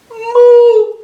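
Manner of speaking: high, blaring moo of fright; loud alarmed moo; scared cow. Acted. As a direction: wail like a terrified cartoon cow. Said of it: "you can tell he's sad and scared".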